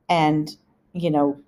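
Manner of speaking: ordinary delivery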